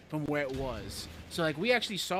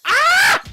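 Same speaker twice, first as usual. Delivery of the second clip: sudden very high, very loud scream; real shriek as he gets blown up; startled scream; 19 dB louder than usual. Real, not acted. Blown up in game.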